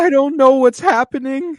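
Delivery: high-pitched, loud, pleading voice; helpless high-voiced plea; Wayman Wang. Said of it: "he went full Wayman Wang".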